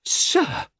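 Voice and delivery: very high, indignant exclamation; scandalised woman's remonstrance; shocked wife. Acted. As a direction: protest sharply in a high voice. Procris protests at the fig.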